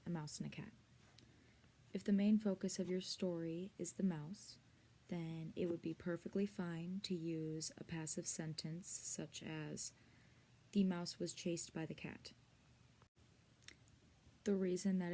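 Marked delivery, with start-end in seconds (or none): none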